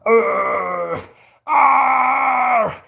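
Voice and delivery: high pained groans; strained cry. Real, not acted. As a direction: groan out the last seconds. Straining at end of plank.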